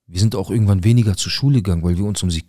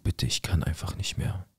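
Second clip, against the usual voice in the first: soft, hushed pleading; quiet, voiceless plea; retold tearful plea; 8 dB quieter than usual. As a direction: weakly imitate someone giving up in tears. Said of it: "Sie fängt an zu weinen".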